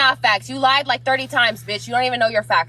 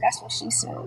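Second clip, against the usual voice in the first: whispered aside, much softer; whispered joke; 9 dB quieter than usual. Real, not acted. Joke aside under breath.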